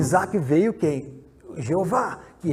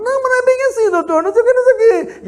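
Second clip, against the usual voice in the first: much higher and louder, mock-sweet old woman; shrill old witch; 10 dB louder, faster than usual. Old woman greeting Rosália, high mock-sweet witch voice. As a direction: play a sugary old witch, pitched way up.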